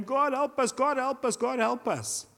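imitated plea, pitched high without shouting; mock desperate chant, high and repeated; crying out. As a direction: plead upward, mildly hammed-up. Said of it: "they're crying out to God".

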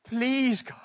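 high, drawn-out plea; acted desperate entreaty; heartfelt pleading. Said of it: "Get the heart of Moses".

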